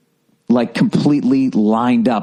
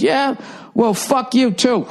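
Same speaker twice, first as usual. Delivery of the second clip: high, snippy brush-off; the caller snapping back before hanging up; rude caller; 12 semitones higher, slower than usual. Retold or imitated. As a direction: toss the insult in a pitched-up voice. Caller insults and hangs up.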